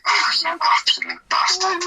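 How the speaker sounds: mock indignant cry at his mates; outraged cry. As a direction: yelp it out like you've just been pranked. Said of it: "And I was just like".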